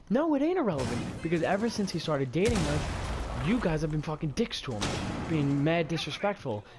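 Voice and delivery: indignant retelling, voice climbing; retold angry comeback. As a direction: fire back in a heated argument. Retelling his heated comeback.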